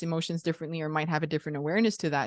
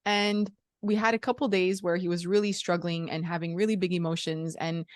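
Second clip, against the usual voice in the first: ordinary talk, nothing marked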